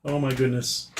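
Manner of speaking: ordinary talk, nothing marked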